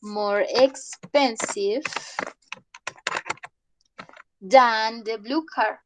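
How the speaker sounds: slow, clear model answer for the class. Shown as a demonstration. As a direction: model the correct answer slowly.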